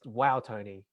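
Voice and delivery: ordinary talk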